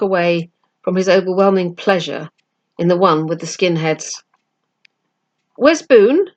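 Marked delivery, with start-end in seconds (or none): 5.6-6.3 a sudden, higher, louder question. Acted. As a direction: blurt it out all at once. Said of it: "he asked suddenly".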